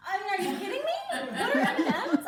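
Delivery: loud, high disbelief; incredulous outburst. Acted. Mother reacting to daughter's question, loudly incredulous. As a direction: echo the question in shrieking mock disbelief.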